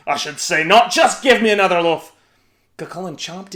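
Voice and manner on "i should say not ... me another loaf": louder demanding giant; giant shouting. A narrator's reading. Giant asking Una for more bread, loud. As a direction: demand it boastfully. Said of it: "shouted Cucullin".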